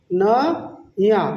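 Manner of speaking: very slow "ন - য়া"; teacher sounding out "নয়া" in two slow parts; broken into syllables. Shown as a demonstration. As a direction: stretch "ন", pause, then "য়া". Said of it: "নেপালিতে বলবো".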